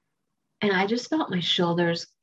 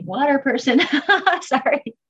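higher, louder, breaking into a laugh; laugh, then a high little apology; amused aside; 7 semitones higher, 6 dB louder than usual. Real, not acted. Joking aside, laughs at herself.

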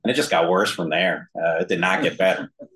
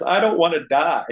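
put-on higher, a touch louder, wailing child; mock tearful scream; 10 semitones higher, 4 dB louder than usual. Five-year-old wailing to his parents, mild mock tearful cry. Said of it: "I just screamed through my tears".

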